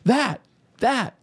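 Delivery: high, appalled stammer; mock scandalized churchgoer; shocked pious lady. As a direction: point in horror. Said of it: "Linda's like".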